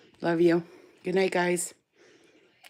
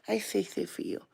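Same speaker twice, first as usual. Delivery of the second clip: ordinary talk, nothing marked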